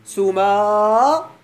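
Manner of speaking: سماء with a long held aa and separate nunation; drawn-out underlying form. Shown as a demonstration. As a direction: stretch the long aa in سماء, then sound a final n. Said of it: "لأن الأصل". (مدّ الألف في سماء ثم نطق نون التنوين ساكنة)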